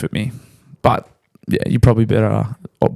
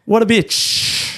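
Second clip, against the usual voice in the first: drawn-out disgust; 8 semitones higher, much slower than usual. Real, not acted. Outraged, slowed repeat.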